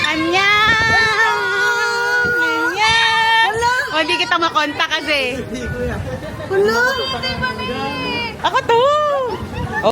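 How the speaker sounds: high, loud, thrilled greeting; excited surprise greeting. Real, not acted. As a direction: burst in beaming with joy. Bursting in to surprise family.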